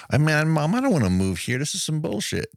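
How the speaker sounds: put-on high-pitched snotty kid complaining; mock a put-upon punk kid, pitched well up; Daniel LaRusso. Son objecting to Mom about the move, snotty and put upon. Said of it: "kind of a snotty, punk-ass kid".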